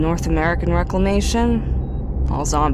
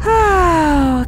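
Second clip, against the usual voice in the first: higher, louder sudden "oh"; startled louder "oh"; 10 semitones higher, 5 dB louder than usual. Acted. Scout suddenly remembering to sign off, a loud startled oh. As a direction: catch yourself off guard.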